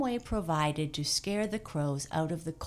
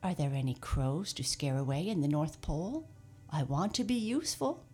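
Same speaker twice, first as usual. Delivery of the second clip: usual speaking voice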